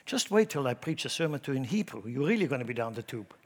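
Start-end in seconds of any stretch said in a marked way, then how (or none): none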